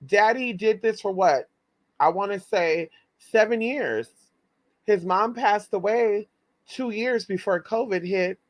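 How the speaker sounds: usual speaking voice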